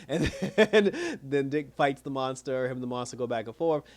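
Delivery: usual speaking voice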